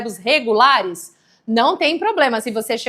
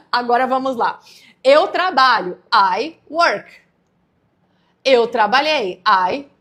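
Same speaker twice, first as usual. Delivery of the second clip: ordinary delivery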